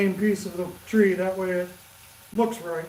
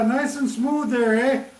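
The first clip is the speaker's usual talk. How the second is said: hammed-up Canadian accent, higher and drawn out; 4 semitones higher, slower than usual. Teasing his friend Jordy, an exaggerated Canadian accent. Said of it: "my Canadian accent there, eh, Jordy".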